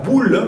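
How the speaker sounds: "boule" high and loud with a lip B; emphatic model of "boule" with B; clear B. Shown as a demonstration. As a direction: pop both lips on "boule". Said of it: "esta sí es B".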